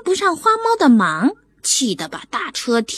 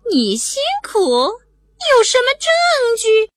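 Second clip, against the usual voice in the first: higher, slow, icy questioning; cold tone; 11 semitones higher, slower than usual. Acted. Cat quoting the owner's cold demand for proof. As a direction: drag it out coldly and doubting. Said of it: "他竟用冷冰冰的口气问我".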